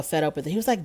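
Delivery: ordinary talk, nothing marked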